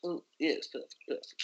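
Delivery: excited whisper under the breath. Real, not acted. Player whispering over a good roll, excited whisper. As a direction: hiss it to yourself, pleased. Quietly delighted at success.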